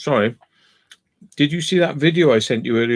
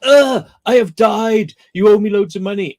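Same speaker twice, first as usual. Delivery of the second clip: mock dying victim; 5 semitones higher, 5 dB louder than usual. Friend mocking fake injury victims, hammed-up dying voice. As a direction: overact a fake collapse for cash. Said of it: "they go".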